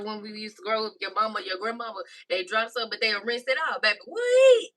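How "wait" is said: loud, very high squealed "wait"; high-pitched outcry. Real, not acted. Cook joking about dropped food, squealed outcry. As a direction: squeal it out in comic alarm.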